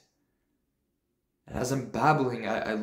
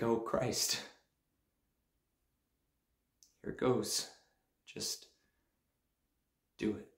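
much softer, steadying yourself; hushed self-pep; 8 dB quieter, slower than usual. Acted. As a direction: breathe and murmur to yourself. Steeling himself before the speech.

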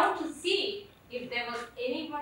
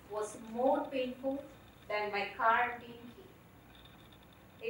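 ordinary talk

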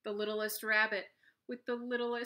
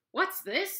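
higher, loud surprised bear; gruff bear question; 10 semitones higher, 6 dB louder than usual. Acted. Big brown bear demanding what the fleeing animals are doing.

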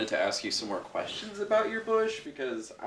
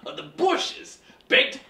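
much higher, much louder accusation; accusing shout; 13 dB louder than usual. Acted. Interviewer yelling at the bean founder, outraged. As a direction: blast the accusation at him.

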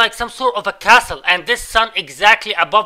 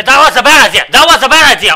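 much louder, higher yell; loud groan of regret; 5 semitones higher, 11 dB louder than usual. Real, not acted. Gamer yelling that the move was a bad idea. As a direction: blurt the regret out.

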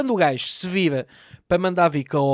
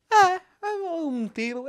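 mock casual; 10 semitones higher, slower than usual. Mock nonchalant aunt.

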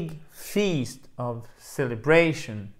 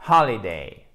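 "holiday" with HOL punched louder; stress on HOL; 3 semitones lower, 6 dB louder than usual. Shown as a demonstration. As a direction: hit the first syllable of "holiday". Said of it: "vous commencez toujours par votre fameux accent tonique".

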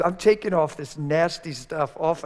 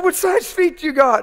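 loud high question; 13 semitones higher, 8 dB louder than usual. Retold or imitated. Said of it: "I said".

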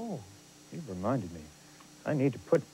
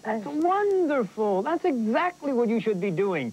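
gushing praise; 10 semitones higher than usual. Acted. Teacher praising student's memorizing work, high-pitched and gushing. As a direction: gush with approval, pitched way up.

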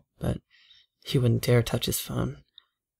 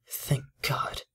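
whispered; 10 dB quieter than usual. Acted. Relieved aside under breath.

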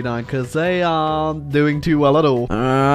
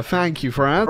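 usual speaking voice

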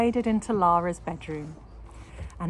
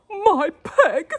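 high, breathless spluttering cry; spluttered; 11 semitones higher, 12 dB louder than usual. A narrator's reading. Husband finding his pig gone, spluttering. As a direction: splutter in shock. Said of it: "spluttered Mister Larrabee".

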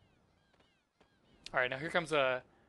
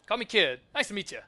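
a hint of a perky game character, pitched up; lightly voiced Kid; 7 semitones higher, 8 dB louder than usual. Kid introducing herself to Serge, lightly voiced.

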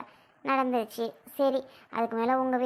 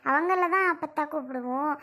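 normal talking voice

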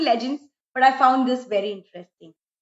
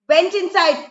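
ordinary delivery

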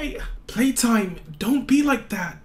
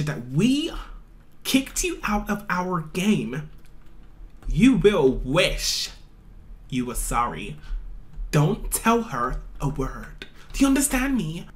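menacing threat; 6 semitones lower than usual. Acted. Bully threatens girl.

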